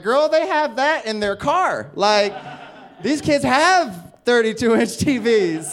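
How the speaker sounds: loud, high, drawn-out disbelief; acted exasperation, stretching the words; retold incredulous retort. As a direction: set her straight, slowly and loudly. Acted incredulous retort to vice principal.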